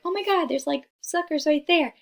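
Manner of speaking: high-pitched mock amazement; imitating excited fifth-grader. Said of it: "like, in fifth grade".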